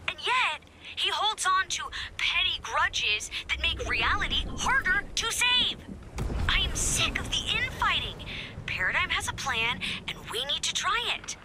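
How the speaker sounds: high, heated frustration; vent, fed up with the bickering; worked-up, high-pitched exasperation; exasperated rant. Acted. Fed up with the infighting.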